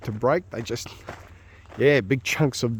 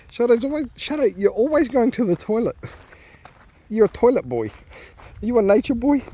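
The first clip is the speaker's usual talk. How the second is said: much higher, sing-song pet voice; 11 semitones higher than usual. Real, not acted. Owner cooing at his dog Shadow in baby talk.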